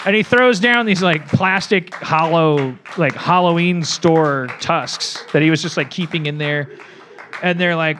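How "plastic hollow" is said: ordinary talk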